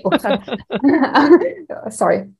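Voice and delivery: ordinary talk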